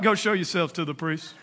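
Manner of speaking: low, quiet throwaway line; offhand. Acted. Jesus to the lepers as he rolls through, offhand. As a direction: toss it off softly in passing. Jesus tosses reply off while passing.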